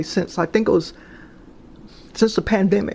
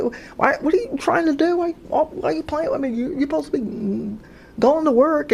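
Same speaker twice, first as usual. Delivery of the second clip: high, pitched-up bewildered protest; startled protest; 8 semitones higher than usual. Retold or imitated. To the fiance she thinks is tugging the covers, high startled protest. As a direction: caught off guard, she demands to know why.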